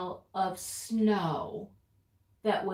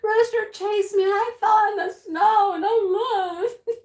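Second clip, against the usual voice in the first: high, loud, hammed-up tearful complaint; mock panicked wail; 10 semitones higher, 6 dB louder than usual. Fallen wife re-enacts telling husband of the chase, panicked wailing. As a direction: wail it out like a distraught victim. Acts out her panicked explanation.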